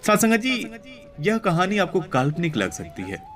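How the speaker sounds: ordinary delivery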